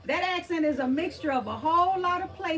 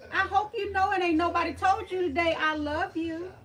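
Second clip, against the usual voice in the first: high, gushing thanks and love; sweet, lifted, openly adoring; effusive affection; 6 semitones higher, 3 dB louder than usual. Real, not acted. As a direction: pour out love to a dear friend. Effusive love to a chat viewer.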